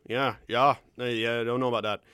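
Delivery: Prairie Canadian accent with hard Ds; lightly done Alberta accent; a touch of heavy Alberta accent. Shown as a demonstration. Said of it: "you get the Alberta accent".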